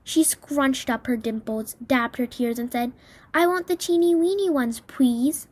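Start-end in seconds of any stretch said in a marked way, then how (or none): 3.3-5.5 put-on high, wheedling child voice; little girl. A narrator's reading. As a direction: plead like a spoiled little girl.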